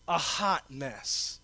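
very slow, low and soft a hot mess. Shown as a demonstration. Pastor repeating his confession, very slow and low. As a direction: stretch every word, low and quiet. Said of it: "I'ma say it one more time".

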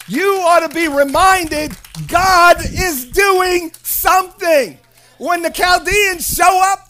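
loud, high, emphatic preacher's proclamation; full-force preaching. Real, not acted. Preacher telling the congregation God is doing something, full-force preaching. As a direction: drive each phrase home to the congregation.

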